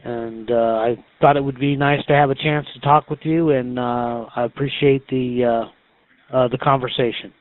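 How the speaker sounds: ordinary talk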